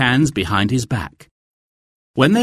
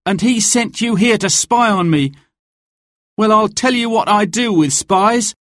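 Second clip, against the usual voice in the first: high-pitched quoted shouting; 11 semitones higher, 3 dB louder than usual. A narrator's reading. Much quotes Sir Guy threatening Will, shouting in a high voice. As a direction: quote the threat high and forceful, leaning on each sentence. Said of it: "started to shout at Will".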